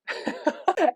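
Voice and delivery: high-pitched burst of laughter; short laugh. Retold or imitated. Student laughing at teacher's frank reply, high laugh. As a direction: break into a quick high laugh. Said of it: "and she laughed".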